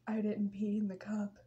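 low, near-whispered admission; hushed confession. Retold or imitated. Patient confessing to her ex, hushed. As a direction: drop to a small, quiet voice.

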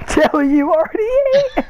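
mocking repeat. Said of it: "repeats line, higher and mocking".

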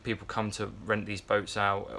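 ordinary talk, nothing marked

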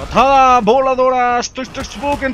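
excited combat shout; battle cry. Real, not acted. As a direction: yell the move like an anime hero. Launching a flying kick attack.

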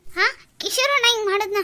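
high, loud tearful cries; sobbing alarm over father; crying in panic. Acted. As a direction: daughter crying out for her collapsed father. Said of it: "ಯಾಕೆ ಪುಟ್ಟಿ ಅಳ್ತೀಯ?".

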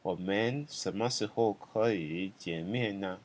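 slow, even teaching read; careful slow demo sentence; slow model sentence. Shown as a demonstration. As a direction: say it slowly so students can follow. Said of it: "So 我们什么时候可以见".

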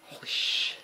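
whisper. Real, not acted. Awed under his breath.

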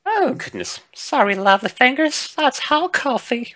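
high-pitched, fussing apology; apologetic game character. Acted. Voices the coffee pourer.

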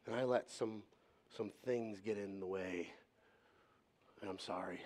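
low, soft retold apology; heartfelt apology. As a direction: recount a sincere apology quietly, with halting pauses. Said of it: "No, I said, ... my heart hurts".

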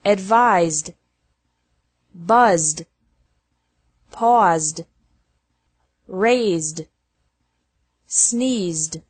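"advised", "buzzed", "paused" ending in a joined Z-D; careful word-list demo, "buzzed" closing straight from Z to D. Shown as a demonstration. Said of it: "E D is pronounced like D".

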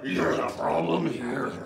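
slow, soft, drawn-out blame; hushed accusation. Acted. Sibling repeating the blame, slow and hushed. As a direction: drop to a slow hush.

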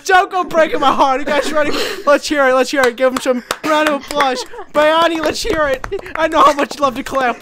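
loud MC hype; hype man. Acted. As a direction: pump up the crowd. Hyping up the karaoke crowd.

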